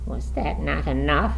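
a touch of fierce reproach; fierce demand. A narrator's reading. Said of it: "he demanded fiercely".

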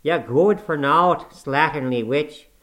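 loud, slow Yorkshire scolding; spiteful tirade. A narrator's reading. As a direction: spit the insults at her like an old servant. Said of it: "directing his discourse to me".

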